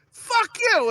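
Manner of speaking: high, drawn-out yelled insult; angry retort. Retold or imitated. Driver cursing a car that honked, angry retort. Acted yell at honking driver.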